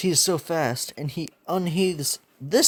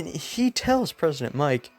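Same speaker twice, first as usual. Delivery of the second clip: ordinary delivery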